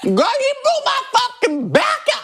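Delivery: very high screeching put-on voice; hammed-up high screech in character; crazy drag screech. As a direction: go wild and shriek it. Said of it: "I was just going, like, crazy".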